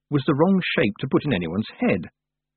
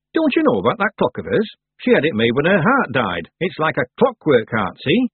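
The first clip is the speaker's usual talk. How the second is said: higher-pitched village character; know-all baker; 5 semitones higher, 4 dB louder than usual. Acted. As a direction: explain it confidently as a village baker. Baker explains the clock.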